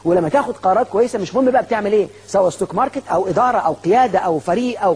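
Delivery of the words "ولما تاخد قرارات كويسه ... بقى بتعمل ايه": ordinary delivery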